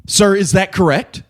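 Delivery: pointed question to a talker; mild mock stern question; stern teacher. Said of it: "And he goes".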